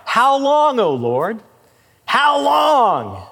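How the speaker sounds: high, loud pleading cry of how long; anguished cry. Acted. Preacher voicing the prophet crying to God, loud and pleading. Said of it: "with this cry".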